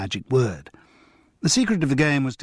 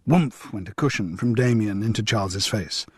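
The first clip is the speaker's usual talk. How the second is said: ordinary delivery, nothing marked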